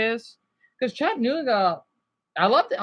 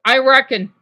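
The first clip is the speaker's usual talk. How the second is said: Southern reckon; 2 semitones higher, 7 dB louder than usual. Shown as a demonstration. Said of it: "I love saying that".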